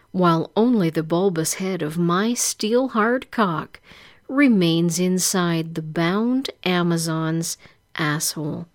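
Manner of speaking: usual speaking voice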